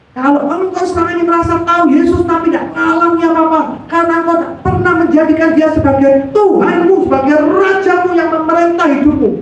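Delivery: imitating a preacher, high and forceful; pendeta. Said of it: "Eh, pendetanya mungkin". (menirukan khotbah pendeta yang berapi-api)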